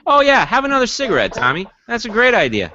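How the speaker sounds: high, mocking sarcasm; teasing a sick friend with sarcasm; sarcastic. Real, not acted. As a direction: needle him about the cigarette.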